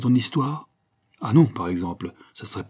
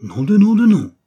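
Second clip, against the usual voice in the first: loud, much higher astonished cry; stunned exclamation; 10 semitones higher, 11 dB louder than usual. Acted. Apache reading his own story in the paper, astonished cry. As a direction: burst out in disbelief. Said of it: "une expression de stupéfaction".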